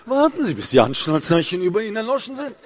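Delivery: imitated cabin announcement singsong; Stewardess. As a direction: parody a flight attendant's rote melody. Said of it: "Das macht eine Stewardess doch nicht freiwillig". (nachgeahmte Stewardess-Durchsage mit Singsang)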